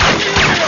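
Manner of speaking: high loud anguished wail; sudden panicked howl. Acted. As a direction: let out a desperate cry.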